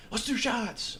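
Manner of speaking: high eager call for shots; rally the group, eager; eager drunk. Retold or imitated. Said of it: "As soon as I'm drinking".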